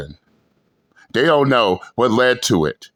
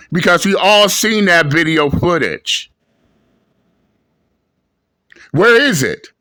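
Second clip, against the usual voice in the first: confronting; 4 semitones higher than usual. Real, not acted. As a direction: push hard at her for proof. Confronting commenter as ma'am.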